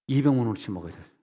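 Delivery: ordinary talk, nothing marked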